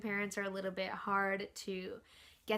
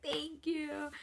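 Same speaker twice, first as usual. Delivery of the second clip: retold tearful thanks; 7 semitones higher than usual. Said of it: "I was still crying".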